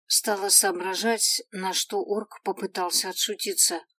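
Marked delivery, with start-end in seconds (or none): none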